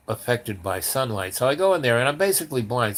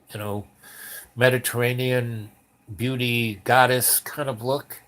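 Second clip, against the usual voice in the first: ordinary delivery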